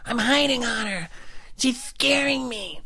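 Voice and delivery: put-on high, squeaky twelve-year-old boy; kid voice John. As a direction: play a pesky little kid on the phone. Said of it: "this twelve-year-old kid, John".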